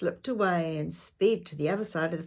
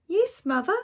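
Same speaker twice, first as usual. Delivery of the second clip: put-on high, eager little girl; 13 semitones higher, 6 dB louder than usual. Girl answering her mother, high and bright. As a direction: chirp it like an obedient child.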